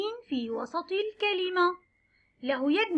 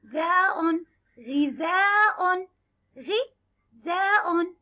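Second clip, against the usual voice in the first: غذاء stretched very slowly, syllable by syllable; slow sounding out; much slower than usual. Shown as a demonstration. Teacher to children, drawing out غذاء slowly. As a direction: sound out غذاء for young pupils. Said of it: "نختار حرف الغين مع كسرة".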